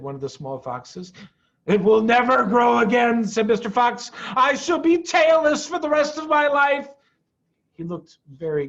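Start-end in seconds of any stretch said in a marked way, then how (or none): 1.7-6.9 higher, louder mournful protest; a fox lamenting his lost tail; gloomy declaration. A narrator's reading. Mr Fox glum about tail.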